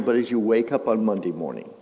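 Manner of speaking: normal talking voice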